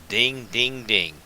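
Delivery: loud sing-song ding ding ding like a bell; bell ding imitation. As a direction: chime it out like the notification bell.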